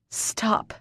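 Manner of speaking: hoarse, breathy rasp; raspy. Acted. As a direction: rasp out the word. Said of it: "I rasp".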